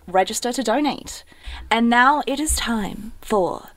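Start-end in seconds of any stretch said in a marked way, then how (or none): 1.7-3.7 slow, drawn-out show intro; segment announcer. Pretend. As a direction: stretch it out like introducing an act.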